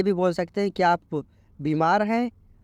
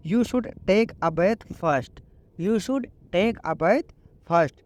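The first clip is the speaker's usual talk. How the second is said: very slow, each English word laid out clearly; slow teaching read; much slower than usual. Shown as a demonstration. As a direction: model the sentence slowly for learners. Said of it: "तो आप उसको English में बोलो".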